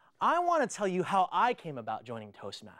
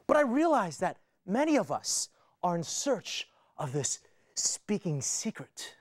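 normal talking voice

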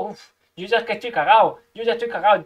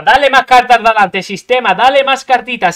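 angry yelling; 9 dB louder than usual. Real, not acted. Angry at game dealing cards.

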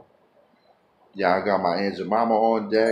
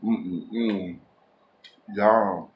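talking with mouth full; savoring a bite; chewing food. Real, not acted. Seen 1: Bites sausage at -2.0; lips closed, jaw working during "mm-mm-mm.

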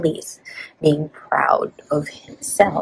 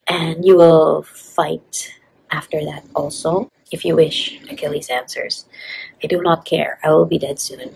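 livelier story reading. A narrator's reading. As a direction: give each king a clear spoken line. Reads the novel aloud.

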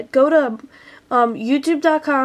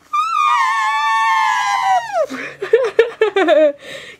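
elephant trumpet. Shown as a demonstration. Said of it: "I'm gonna do my elephant noise".

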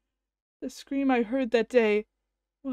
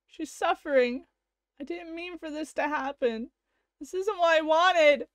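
usual speaking voice

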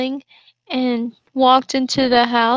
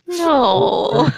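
mock wail; 3 semitones higher than usual. Real, not acted. Protesting friend's joke.

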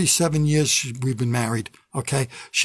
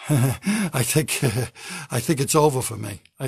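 usual speaking voice

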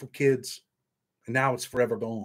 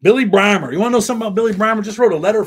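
excited; 7 semitones higher, 10 dB louder, faster than usual. Real, not acted. Excited outburst about a friend.

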